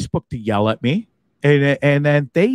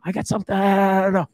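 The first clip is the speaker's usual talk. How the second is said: usual speaking voice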